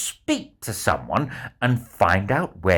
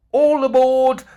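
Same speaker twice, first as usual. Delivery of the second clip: much higher and louder boarding call; 13 semitones higher, 7 dB louder than usual. Acted. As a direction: call it across the busy harbour. Boarding call across the harbour.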